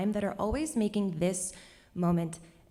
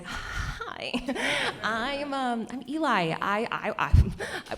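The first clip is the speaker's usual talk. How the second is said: awkward introduction; 5 semitones higher, slower than usual. Retold or imitated. Awkwardly introducing herself to strangers.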